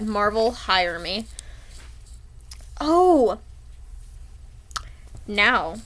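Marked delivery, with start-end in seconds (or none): 2.8-3.4 loud high startled oh; sudden loud oh; surprised oh. Real, not acted. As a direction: blurt it out, caught off guard. Sudden exclamation.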